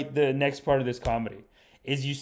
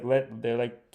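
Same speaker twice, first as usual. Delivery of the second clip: ordinary delivery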